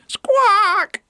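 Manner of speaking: one long, piercing squawk; very high, loud bird cry; parrot squawk. Acted. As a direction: screech like a noisy parrot. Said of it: "Pedro's squawks".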